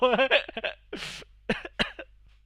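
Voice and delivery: high, loud laugh bursting out; loud burst of laughter. Real, not acted. Laughing loudly at his friend's misfortune. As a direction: crack up loudly at the news.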